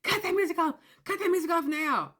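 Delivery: high mock angry order; mock angry demand. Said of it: "You're like".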